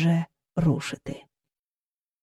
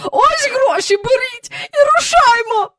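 very high, loud, tearful hurry; frantic urging; 5 dB louder than usual. A narrator's reading. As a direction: push the money at him in a panic. Miss Andrew urges the driver.

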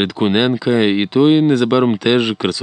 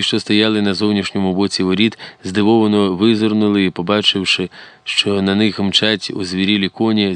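ordinary delivery, nothing marked

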